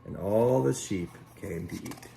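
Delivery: ordinary delivery, nothing marked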